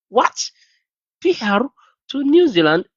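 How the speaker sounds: high, stunned disbelief; incredulous disbelief. Retold or imitated. Reacting in shock to a nurse promised New Zealand PR, stunned. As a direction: say it like you can't believe your ears.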